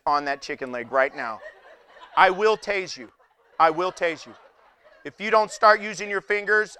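low, gruff cop giving orders, laughing through it; stern police officer. Pretend. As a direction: play the officer barking at a tourist. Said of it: "be that officer".

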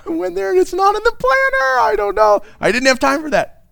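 high, hammed-up excuse voice; mock excuse-making. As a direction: whine out a flimsy excuse. Acts out an absurd excuse.

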